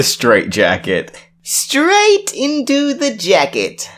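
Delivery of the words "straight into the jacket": very high, slow playful voice; high sing-song pun. Pretend.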